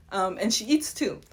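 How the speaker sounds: ordinary delivery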